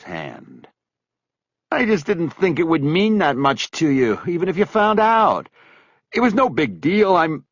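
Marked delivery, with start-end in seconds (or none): 1.7-7.4 high whining excuse; pleading, whiny. Acted. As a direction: whine it like a guilty friend begging. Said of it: "that pleading, whiny voice".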